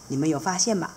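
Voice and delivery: plain reading voice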